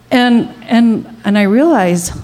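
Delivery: usual speaking voice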